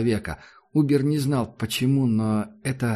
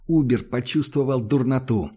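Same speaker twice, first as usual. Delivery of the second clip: usual speaking voice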